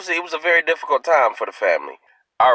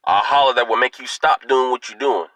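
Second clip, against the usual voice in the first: normal talking voice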